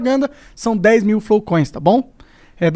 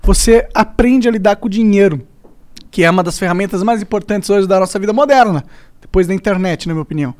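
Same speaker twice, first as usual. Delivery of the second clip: ordinary talk, nothing marked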